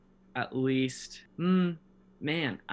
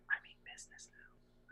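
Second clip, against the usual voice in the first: whisper; 16 dB quieter than usual. Retold or imitated. Voicing a text's period.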